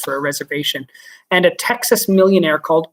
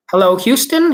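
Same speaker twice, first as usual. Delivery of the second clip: louder, higher call over the radio; cried into the mic; 5 semitones higher, 5 dB louder than usual. Acted. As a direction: call out to Houston urgently. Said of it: "he cried into the mic".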